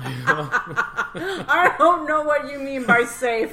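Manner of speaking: high, loud, slowed by laughter; helpless laughing protest. Real, not acted. Co-host disputes that farting is safe, helpless laughing protest. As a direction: squeeze the words out through real laughing. Cracks up at safety claim.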